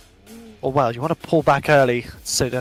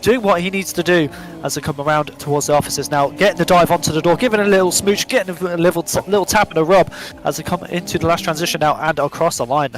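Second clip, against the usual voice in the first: excited, louder running call; live race commentary; 4 semitones higher, 5 dB louder than usual. Real, not acted. Commentator following the car right on his rival, excited live commentary. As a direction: build excitement with each tap and move.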